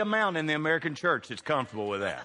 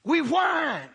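a sudden preacher's shout, higher and louder; 10 semitones higher, 3 dB louder than usual. Acted. Preacher to his congregation about whining, a loud high shout. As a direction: belt it out to shame the whiners.

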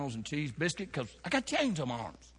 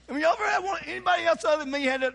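high excited appeal; 12 semitones higher, 3 dB louder, faster than usual. Real, not acted. Asks congregation, high excited.

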